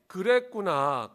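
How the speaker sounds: slow, drawn-out 그랬구나 ending in a fall; leading the congregation in a slow 그랬구나; empathetic. Shown as a demonstration. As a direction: lean into 그랬구나 slowly and kindly. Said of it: "여러분".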